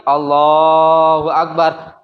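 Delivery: slow, drawn-out recitation; demonstrating takbir. Shown as a demonstration. Teacher showing students the opening takbir, slow recitation. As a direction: stretch the syllables long and steady.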